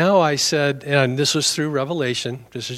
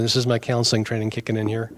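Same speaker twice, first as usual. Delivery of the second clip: ordinary talk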